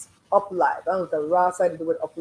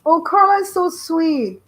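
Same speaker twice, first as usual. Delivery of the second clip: gushing; 8 semitones higher, 7 dB louder, slower than usual. Real, not acted. Gushing over a viewer.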